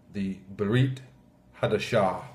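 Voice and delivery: Berit Hadashah said with Hebrew sounds, beh-REET hah-dah-SHAH; Hebrew term. Shown as a demonstration. Pastor giving the Hebrew for New Covenant, Berit Hadashah in Hebrew. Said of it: "the New Covenant".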